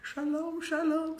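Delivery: normal talking voice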